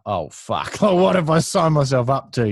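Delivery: a mock-horrified thought, laughing through it; mock inner dread. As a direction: laugh at your own panic as you voice it. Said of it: "I'm like, in my head".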